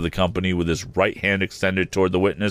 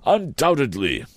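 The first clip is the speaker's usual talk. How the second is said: high, fussy Mr Wopsle voice; pompous Wopsle; 9 semitones higher than usual. A narrator's reading.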